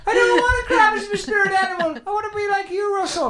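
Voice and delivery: very high, fast mock-childish whine; hammed-up whiny plea. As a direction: plead like a sulky kid. Whining about spirit animal.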